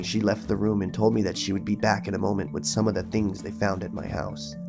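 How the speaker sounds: usual speaking voice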